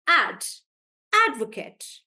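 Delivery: "Ad" punched alone, then "advocate" as AD-vuh-kut; stress on AD. Shown as a demonstration. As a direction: hit "Ad" hard, let the rest of "advocate" fall. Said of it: "stress on the first part".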